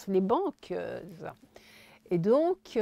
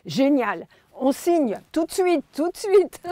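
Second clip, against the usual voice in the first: loud high eager yes; excited shout; 8 semitones higher, 9 dB louder than usual. Retold or imitated. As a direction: shout it with urgent delight. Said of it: "Il me dit". (cri enthousiaste de l'éditeur)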